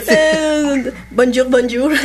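a giggly, hammed-up bonjour with a French accent; laughing French greeting. Shown as a demonstration. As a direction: try the French accent and crack up. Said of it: "pronúncialo".

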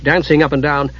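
plain reading voice

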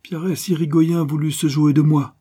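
high, loud ranting boast; narrated villain working himself up; increasingly exalted. A narrator's reading. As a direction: get carried away by your own crimes. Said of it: "s'exaltant de plus en plus".

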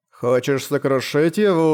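slow, lisping knight voice; slushy lisp. Acted. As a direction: lisp it slowly to the sword. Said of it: "Странный шепелявый голос".

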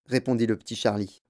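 usual speaking voice

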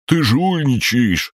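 high, drawn-out indignant squawk; slow, high cry of protest. A narrator's reading. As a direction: accuse in outraged high pitch. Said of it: "возмутился зелёный".

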